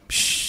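long hiss of air; soft, voiceless whistle-like exhale; awed "pshhh". Real, not acted. Preacher impressed by such forbearance, awed hissing exhale. Wordless sound of awe.

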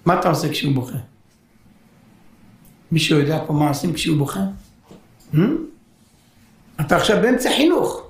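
ordinary delivery

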